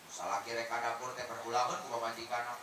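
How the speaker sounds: ordinary delivery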